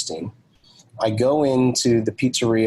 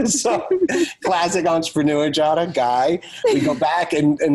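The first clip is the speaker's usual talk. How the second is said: higher-pitched talk through laughter; laughing; 7 semitones higher than usual. Real, not acted. Storyteller laughs at himself for forgetting the pizzas, laughing. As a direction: laugh at yourself while carrying on the story.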